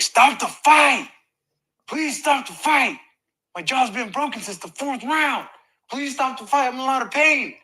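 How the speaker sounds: desperate pleading. Retold or imitated. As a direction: plead like your jaw is broken. Retold begging to stop the fight.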